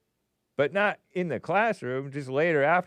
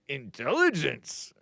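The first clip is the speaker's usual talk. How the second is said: slow, strangled word with leaping pitch; Yoda-like dim mockery; mocking voice; slower than usual. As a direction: drag the word out as if it's absurd. Same mocking voice from earlier demo.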